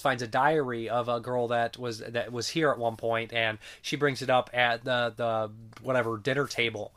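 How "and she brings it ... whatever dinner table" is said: ordinary talk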